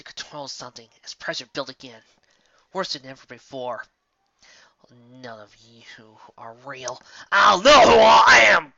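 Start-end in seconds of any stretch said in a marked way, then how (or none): 4.9-7.0 defiant whisper. Acted. Denying the nightmare. 7.3-8.7 defiant shout. Acted. Defiant cry at nightmare.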